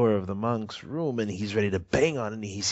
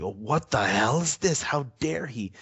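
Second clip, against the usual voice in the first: a touch of indignant outrage, pitched up; hammed-up offended poet, higher; mock outrage; 5 semitones higher than usual. Su Dongpo opening the monk's reply, mock outraged. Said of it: "He says, like".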